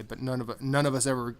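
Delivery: ordinary delivery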